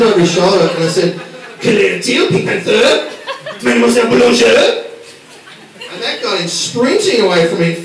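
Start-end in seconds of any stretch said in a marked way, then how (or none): 1.6-4.8 faux French accent. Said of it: "I put on my faux accent".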